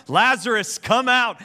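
high, loud call into the tomb; loud, high summons to the dead man; commanding call. Retold or imitated. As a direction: call Lazarus out with full authority. Passion play Jesus calls Lazarus.

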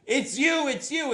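high, loud cries of recognition; excited shout. Retold or imitated. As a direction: yell it out, pointing him out. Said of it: "she points and goes".

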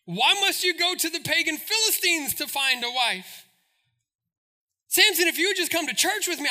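a fretting church mom pleading; pleading mother. Retold or imitated. Mother pleading with Samson to find a church girl, high and fast. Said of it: "His father and mother objective".

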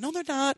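high, loud flat no; emphatic denial. Real, not acted. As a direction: shoot it down hard. Rebutting the mocked claim.

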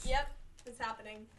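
ordinary talk

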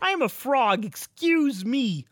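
high, slow little frog voice. Acted. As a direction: play a prissy cartoon frog. Insists he is a frog.

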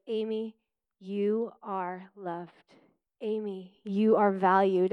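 slow, soft and gentle, like affirmations read aloud; reassuring recording. Retold or imitated. Quoting her healing recording, slow gentle affirmation. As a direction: lightly voice the recording's calm reassurance. Recorded identity statements quoted.

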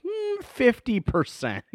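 high, slow, drawn-out reluctant estimate; mock hedging. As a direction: stretch it out high, as if reluctant to admit it. Acts out hedging estimate to parents.

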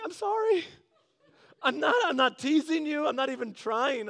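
sheepish laughing apology to an annoyed coworker. Retold or imitated. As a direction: laugh it off while saying sorry. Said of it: "I'm like".

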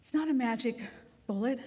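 normal talking voice

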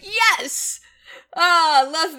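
high, drawn-out delighted yes; delighted cheer. Retold or imitated. Podcaster recalls cheering her favorite moment, delighted and high. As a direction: relish it, pitch leaping up. Retells delighted reaction to scene.

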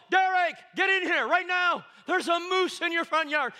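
high, loud excited yell; high-pitched yell to the next room; retold yell. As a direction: call him in like the house is on fire. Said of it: "I yelled".

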